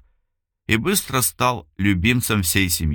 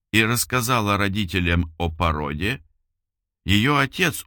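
usual speaking voice

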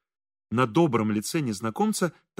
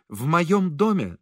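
ordinary delivery, nothing marked

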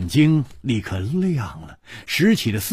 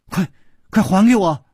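high, loud, impatient order; agitated princess raising her voice; urgent demand; 10 semitones higher, 5 dB louder than usual. Acted. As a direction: demand it back at once. Princess demands her silk scarf.

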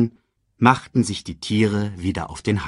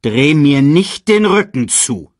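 loud, slow royal order; stern command; 6 semitones higher, 7 dB louder than usual. A narrator's reading. King orders his brother not to walk away, stern loud command. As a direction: order him loudly and deliberately. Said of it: "befahl Mufasa".

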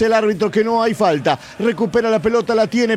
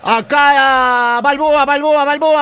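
high, loud repeated name; excited goal call; 5 semitones higher, 4 dB louder, slower than usual. Real, not acted. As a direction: build to the goal shout. Goal shout calling scorer's name. (grito de gol con el nombre del goleador)

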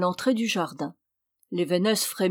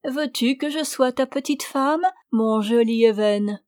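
higher, cajoling proposal; coaxing old witch; 5 semitones higher, 3 dB louder than usual. A narrator's reading. Old witch asking Even to marry her, coaxing. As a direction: wheedle sweetly as the old hag. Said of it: "d'un ton câlin".

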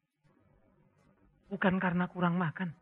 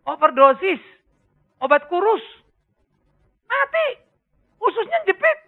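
high, loud, listing each cause; emphatic shout; 10 semitones higher, 9 dB louder than usual. Acted. Preacher warning the congregation, shouting each cause. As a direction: hammer each word out to the congregation.

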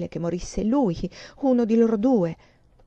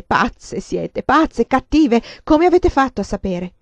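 loud, higher, slowed accusation; angry outburst; 4 semitones higher, 7 dB louder, slower than usual. Acted. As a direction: lash out, hurt and angry. Distraught girl berates friends. (accusa rabbiosa)

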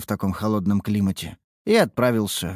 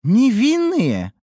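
scoffing; 9 semitones higher, 4 dB louder than usual. Acted. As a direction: snort at the idea. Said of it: "хмыкнула высокая".

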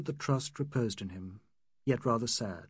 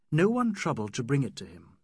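usual speaking voice

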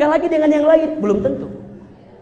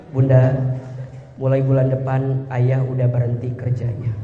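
lower, quiet husband breaking bad news; husband telling his wife he lost his job, low and quiet; worried husband; 11 semitones lower, 6 dB quieter than usual. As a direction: confess the job loss in a low voice. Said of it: "suami pulang bilang gini".

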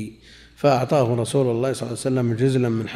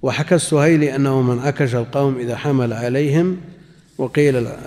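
normal talking voice

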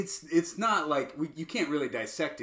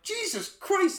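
much higher stunned exclamation; overwhelmed; slower than usual. Retold or imitated. Viewer overloaded by the movie, high. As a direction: blurt it out overwhelmed.

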